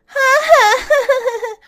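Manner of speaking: high, loud laughing haa haa; mocking laugh. Neighbour laughing loudly at the quarrelling women.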